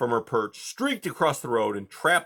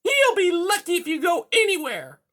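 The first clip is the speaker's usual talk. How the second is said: high, loud, snappish cat villain; high-pitched angry threat; snapping cat; 7 dB louder than usual. A narrator's reading. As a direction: snap it in a piercing high voice. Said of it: "Silverside snapped".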